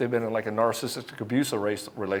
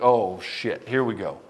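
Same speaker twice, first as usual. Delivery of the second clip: lower, knowing resignation; see the crash coming, lower; resigned sigh; 5 semitones lower, slower than usual. Retold or imitated. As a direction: coach mutters to himself about doomed client, lower and resigned. Coach's inner resigned reaction.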